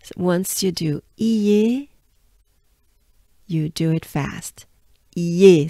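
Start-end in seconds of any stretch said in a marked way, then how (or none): 5.1-5.7 "Ye" with the e hit hard; stress the e. Shown as a demonstration. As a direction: lean on the e in "Ye". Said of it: "so you accentuate the E".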